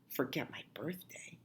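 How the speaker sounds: soft whispered reminder. Acted. Child reminding parent of birthday, whispered. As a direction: say it under your breath.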